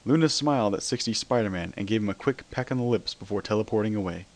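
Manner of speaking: usual speaking voice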